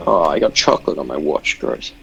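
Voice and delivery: usual speaking voice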